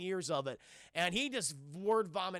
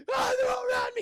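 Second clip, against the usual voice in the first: high, loud hammed-up shriek; wailing imitation of sheer terror; mock screaming panic; 8 dB louder, faster than usual. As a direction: scream your head off as a hallucinating victim. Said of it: "fucking screaming his head off".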